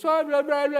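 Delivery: high, loud whiny blah blah; mocking blah blah. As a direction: parody a complainer's whining. Mocking a complainer.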